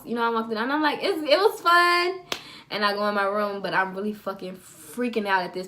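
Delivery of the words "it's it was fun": high, drawn-out, put-on cheer; faking fine. Teen hiding her hurt eye from mom, fake cheerful. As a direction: stretch it out high, pretending all is well.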